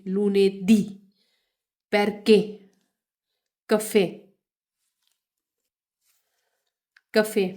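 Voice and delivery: लूने दी, perché, cafe with the last syllable hit loud; teacher stressing the final accented syllable. Shown as a demonstration. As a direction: punch the accented end of each word. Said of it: "इसके ऊपर ज़ोर देंगे".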